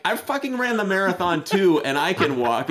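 high, mock-exasperated complaint; telling off a friend who won't walk, mock-exasperated; retold angry retort. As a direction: snap back at a lazy friend, half-acted. Retold angry reply to buddy.

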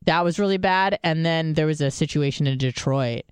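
ordinary delivery

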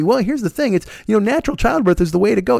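high, prissy know-it-all voice; mock preachy advocate. Natural-birth advocate lectures him, mocking high smug voice. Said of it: "Nobody's fucking telling me".

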